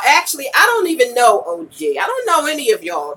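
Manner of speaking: normal talking voice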